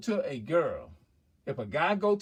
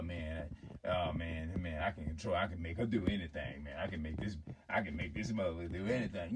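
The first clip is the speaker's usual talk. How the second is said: low, soft guy-to-guy talk; man confiding in another man; 8 semitones lower, 6 dB quieter than usual. Acted. Man talking privately to another man about a woman, low boasting. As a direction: brag low and offhand, as between two men.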